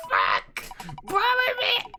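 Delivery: very high pleading squeal; squealing despair. Real, not acted. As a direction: wail at the wheel for your game. Wheel passes Bomberman, dismay.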